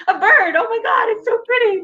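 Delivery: high, laughing fake sob; mock weepy gushing. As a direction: ham up crying over a bird. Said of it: "No, I'm just kidding".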